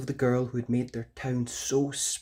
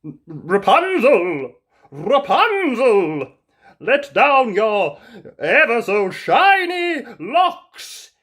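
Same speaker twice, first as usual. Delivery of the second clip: loud, slow flattering call; prince calling again; 10 semitones higher, 11 dB louder, much slower than usual. Acted. Prince flattering Rapunzel from below, loud slow calling. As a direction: stretch it out, sweet-talking up to the window. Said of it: "prince calls again".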